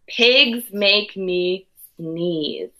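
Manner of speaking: plain reading voice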